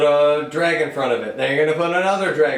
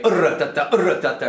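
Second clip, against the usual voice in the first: fast vocal drum; faster than usual. Shown as a demonstration. Teacher showing how the rudiment sounds at speed. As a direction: rattle it off quickly. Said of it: "in real literature".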